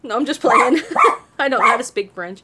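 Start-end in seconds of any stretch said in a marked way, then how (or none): 0.9-1.3 squealing high laugh; real giggling fit; high-pitched laughter. Real, not acted. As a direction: burst out laughing at the joke. Laughs at own joke.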